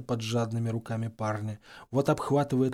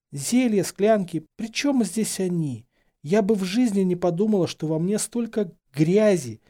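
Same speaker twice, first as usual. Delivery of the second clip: high, distraught self-reproach; tearful outburst; 7 semitones higher, 4 dB louder than usual. A narrator's reading. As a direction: protest through tears, sinking on the last word. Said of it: "Последнее слово потонуло в очередном всхлипе". (слёзно и высоко, с отвращением к себе)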